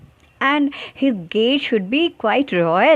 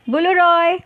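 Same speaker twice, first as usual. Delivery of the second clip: higher-pitched watchman's call; 5 semitones higher, slower than usual. A narrator's reading. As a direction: call the name out, high and sustained. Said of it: "चौकीदार ने आवाज़ लगाई". (चौकीदार की तरह पुकारते हुए)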